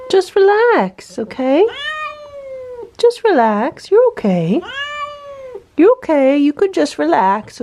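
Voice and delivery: high, coaxing voice for a scared cat; soothing pet talk. Real, not acted. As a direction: coax the cat gently.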